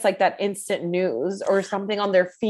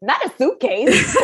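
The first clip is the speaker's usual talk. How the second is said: laughing; 10 semitones higher, 4 dB louder, slower than usual. Retold or imitated. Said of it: "I was just like".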